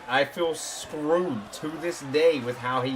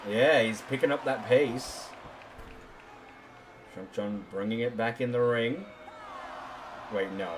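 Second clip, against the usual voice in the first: wrestling commentator; 6 semitones lower, 6 dB quieter than usual. Acted.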